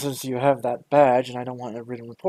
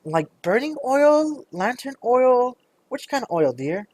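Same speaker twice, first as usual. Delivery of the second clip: put-on high, sugary old-lady voice; a petite shopkeeper welcoming a customer in a high sweet voice; sweet little shopkeeper; 9 semitones higher, slower than usual. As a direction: greet them like a doting granny. Shopkeeper voice continues.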